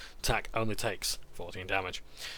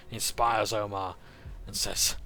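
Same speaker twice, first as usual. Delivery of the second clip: usual speaking voice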